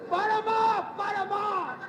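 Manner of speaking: loud, high shouted dare; acted bravado, calling someone out to fight; fighting challenge. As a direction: belt it out like a boxer squaring up. Challenging his father to fight.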